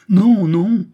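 loud, high-pitched cry of refusal; outcry. A narrator's reading. Marquis refusing the dying woman's inheritance, outcry. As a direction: cry out in protest. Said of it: "s'écria le marquis".

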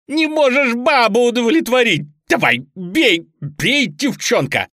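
high, loud, goading screech; frenzied yell. A narrator's reading. Bound boy egging on his captor, frenzied yell. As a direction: scream taunts, daring him to hit. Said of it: "орал Лёня, которого невозможно было узнать".

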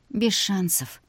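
softly sighed, understated resignation. A narrator's reading. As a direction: let it out like a small sigh. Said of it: "вздохнула Вика".

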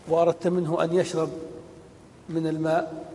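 ordinary delivery

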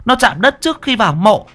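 high-pitched urgent orders, building in pitch; rally the lads with an urgent warning; old village woman taking charge; commanding old woman. Acted.

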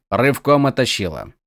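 plain reading voice

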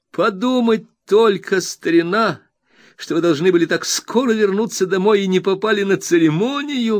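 high, bright, eager friend's voice; excited Traddles. A narrator's reading. Traddles reassuring his dear Copperfield, high and overjoyed. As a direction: bubble over with glad welcome. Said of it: "воскликнул Трэддлс".

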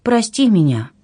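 high, apologetic plea; guilty. A narrator's reading. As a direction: ask forgiveness sheepishly. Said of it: "Она виновато потупилась".

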